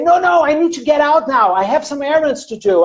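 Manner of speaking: high-pitched, acted brush-off; protesting refusal. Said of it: "And you say".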